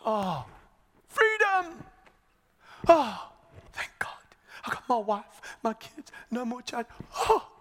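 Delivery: high cry giving way to breathless whispering; acted whispery, overwhelmed thanks; overjoyed relief. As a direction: gasp with tearful relief. Acts out freed servant's relief.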